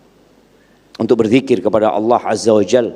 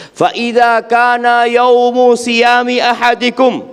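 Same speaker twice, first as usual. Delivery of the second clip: raised, ringing preacher's voice; emphatic preaching; 10 semitones higher, 4 dB louder than usual. Acted. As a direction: proclaim the teaching to the congregation.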